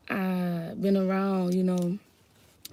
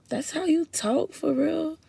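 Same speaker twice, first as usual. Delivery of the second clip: high-pitched, drawn-out mock disbelief; surprised students; 8 semitones higher than usual. Students quizzing their teacher on her accent, mock surprise. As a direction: imitate students' incredulous question, higher and slower. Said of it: "my students was like".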